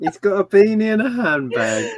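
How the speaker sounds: high-pitched Brummie echo; mock Brummie. Friend echoing the Brummie impression, high mock Brummie.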